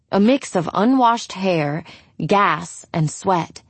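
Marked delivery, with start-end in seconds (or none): none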